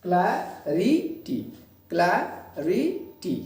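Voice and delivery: "क्लैरिटी" slowly as three beats, cla-ri-ty; a pause after each syllable of "क्लैरिटी"; split into syllables. Shown as a demonstration. Teacher showing three syllables to students, syllable by syllable. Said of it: "इसमें तीन syllables हैं".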